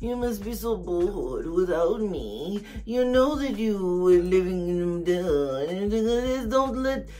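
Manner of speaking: slow and soft, strange put-on voice; Truman's voice. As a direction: imitate a languid, soft-spoken Truman. Truman's reply to Slim.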